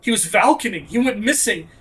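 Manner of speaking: ordinary delivery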